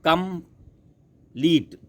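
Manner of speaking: Complete very slow and high, COM, PLETE apart; syllable by syllable. Shown as a demonstration. Teacher counting two syllables, complete split slowly. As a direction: leave a long gap inside complete. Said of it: "टू है बच्चों".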